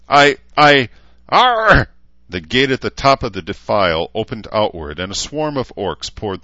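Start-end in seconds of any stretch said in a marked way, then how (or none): 0.1-1.9 very high, loud stammer into a roar; sputtering rage. Acted. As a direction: choke on fury then explode.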